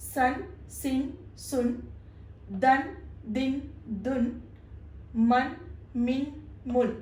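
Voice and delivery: സൻ, സിൻ, സുൻ through മൻ, മിൻ, മുൻ as separate syllables, all ending in n; measured teacher's drill of സൻ, ദൻ, മൻ and their i and u forms; pronunciation drill. Shown as a demonstration. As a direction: recite the sets from സൻ to മുൻ in threes, pausing after each syllable. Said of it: "ഇതാണ് തൻവീന്റെ pronunciation".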